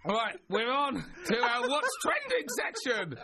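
high, slow, cracking with laughter; helpless laughter. Real, not acted. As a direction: squeal the link through laughter. Laughing while trying to move on.

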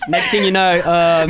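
ordinary talk, nothing marked

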